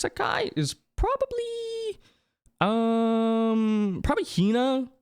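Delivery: high, drawn-out wondering tone; squeaky musing. Pretend. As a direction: stretch it out in a squeak while thinking.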